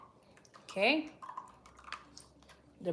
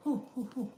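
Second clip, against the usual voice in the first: soft high hot-bread oohs; surprised little oohs; 4 semitones higher, 5 dB quieter, much faster than usual. Real, not acted.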